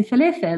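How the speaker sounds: falafel as fel-a-fel, Arabic vowels; Arabic pronunciation. Shown as a demonstration. Showing the host her Arabic falafel. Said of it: "the way I say those words".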